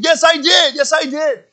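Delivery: high, excited mock boyish yell; high-pitched insistent boy. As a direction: imitate a son insisting in triumph. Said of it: "so it's really high pitch".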